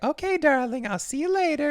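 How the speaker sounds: high mock-sweet sign-off; imitated breezy goodbye. Samantha ends the call with Darren, high breezy imitation. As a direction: pitch it up, pretending all is fine. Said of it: "after she's like".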